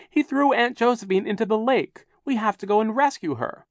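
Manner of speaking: put-on high, loud, urgent child; shouted accusation. A narrator's reading. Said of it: "Violet shouted".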